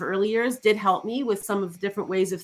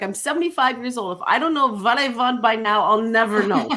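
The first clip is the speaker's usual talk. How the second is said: hammed-up Dutch accent of an older client; badly faked Dutch accent; 2 semitones higher, 4 dB louder than usual. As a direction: imitate a stubborn older woman with a Dutch accent. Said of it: "That was her Dutch accent".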